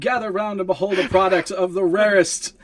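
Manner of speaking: high, loud town-crier proclamation; crier's yell. Acted. Performer rousing the rabble from atop a table, crier's yell. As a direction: yell it out like a showman drawing a crowd. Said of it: "I yell out".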